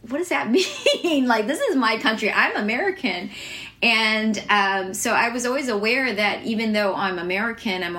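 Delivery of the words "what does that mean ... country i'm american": higher, half-laughing protest; mock-baffled comeback, pitched up; incredulous retort. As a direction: push back in baffled disbelief, retelling it. Retort to kids' taunt.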